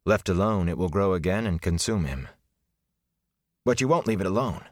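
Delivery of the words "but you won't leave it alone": urgent half-pleading demand; begging and ordering at once, quick; half plea, half command. A narrator's reading. A youth pressing the Aes Sedai, half plea half command. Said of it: "half plea, half command".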